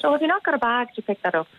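really nice. Shown as a demonstration. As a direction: coax gently, all friendliness. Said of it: "I was really nice to him".

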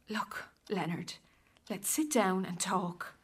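hushed, slow plea to calm down; whispered reconciliation; soft whisper. Acted. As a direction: coax him gently to sit. Wife softly tries to calm him.

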